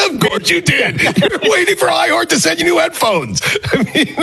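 high-pitched, talking through laughter; real laughter running through the words; laughing tease. Real, not acted. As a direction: tease him while cracking up. Co-host laughing while teasing him.

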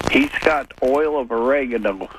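ordinary talk

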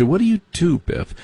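high, lilting snob's what do you do; snooty preppy question. Retold or imitated. Mocking how rich kids ask about work, in a high snooty voice. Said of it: "They say".

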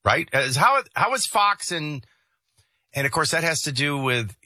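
ordinary talk, nothing marked

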